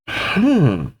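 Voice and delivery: usual speaking voice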